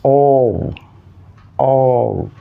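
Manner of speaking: normal talking voice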